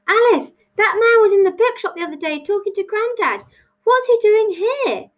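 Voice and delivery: high, urgent alarm; urgent plea, pitched right up; panicked. A narrator's reading. Tilly warns Alice about the man, panicked.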